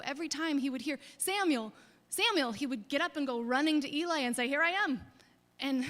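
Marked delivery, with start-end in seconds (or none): 1.2-2.5 raised, higher calls of the name; calling out. Acted. God calling young Samuel by name, raised call. 4.5-5.0 put-on higher and louder, eager boy; a boy running in, eager to serve; eager answer. Young Samuel answering Eli, eager and raised.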